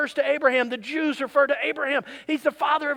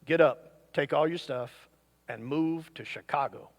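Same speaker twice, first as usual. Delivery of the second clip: low, hushed heavenly voice; 8 semitones lower, 5 dB quieter than usual. Pretend. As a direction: speak low like God calling from above. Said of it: "you hear a voice from heaven go".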